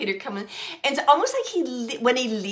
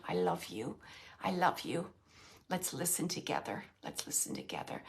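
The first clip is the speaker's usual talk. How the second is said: softer and softer, fading to a whisper; softly tender; 5 semitones lower, 11 dB quieter than usual. Acted. Medium voicing the husband soothing his wife, fading softly. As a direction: hold her hand with the words.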